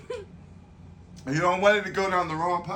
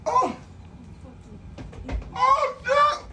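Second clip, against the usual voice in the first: high pained shout; much slower than usual. Real, not acted. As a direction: yelp as the heat hits. Burning from hot sauce.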